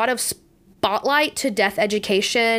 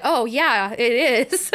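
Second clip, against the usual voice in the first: laughing; much slower than usual. Retold or imitated. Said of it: "you're gonna be like".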